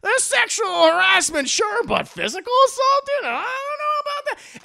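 high-pitched, loud comic protest; hammed-up shocked bystander; mock alarmed objection. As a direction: object in exaggerated squeaky disbelief. Acting bullies' shocked objection.

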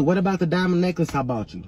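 ordinary talk

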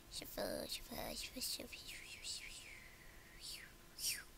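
hushed, breathy whisper. Acted. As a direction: whisper it to yourself. Whispering while shuffling outfits.

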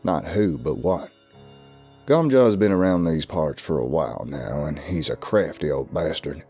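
ominous southern warning; lightly voiced, foreboding old man. A narrator's reading. Southerner warning the stranger of Gumjaw, ominous. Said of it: "replied in an ominous tone".

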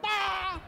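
a sharp, very high mocking "Bah!"; scoffing bah. Friend across the lunch table scoffing at the boss. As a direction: spit out a dismissive scoff.

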